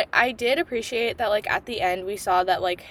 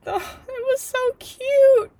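gushing squeal; 13 semitones higher, slower than usual. Real, not acted. Gushing over the couple.

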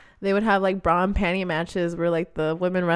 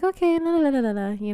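put-on high, singsong little-kid la-la; carefree little kid; 8 semitones higher, faster than usual. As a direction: play a young child humming off on an errand. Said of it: "like, six or seven years old".

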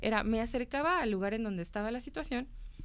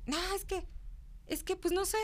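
high, evasive mock kid voice; imitating a sheepish teenager; hesitant student; 8 semitones higher, 4 dB quieter than usual. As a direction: dodge the question in a small high voice. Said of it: "Y se quedaban de".

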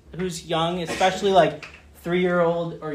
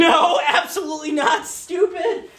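mock scoff; 9 semitones higher than usual. Mock answer to who seeks.

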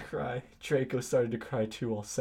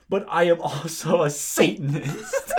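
loud, raised, hammed-up declaration; angry; 4 semitones higher, 6 dB louder than usual. Hagrid reveals he is a Satanist, mock-angry declaration. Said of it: "Hagrid paused angrily".

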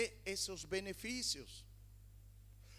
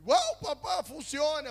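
put-on high, loud, amazed child's cry; son's delighted wow; 7 semitones higher, 9 dB louder than usual. Son amazed his prayer worked, loud delighted cry. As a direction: burst out like a thrilled boy.

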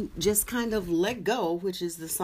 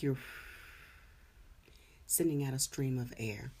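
guided meditation; 5 dB quieter than usual. Acted. Leads a meditation.

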